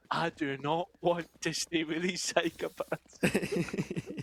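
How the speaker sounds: very slow, deliberate Scottish words; slow Scottish accent. Retold or imitated. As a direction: spell it out slowly, deadpan. Quoting himself to his dad.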